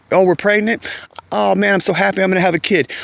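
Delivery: high, excited father-to-be impression; mock delight. Father-to-be hearing of a pregnancy, mock delighted.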